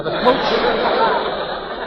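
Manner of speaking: normal talking voice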